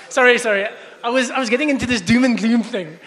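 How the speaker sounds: loud, laughing through the words; laughing apology. Real, not acted. Preacher laughing at himself after a rant. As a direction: laugh at your own doom and gloom. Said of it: "Sorry, I got carried away".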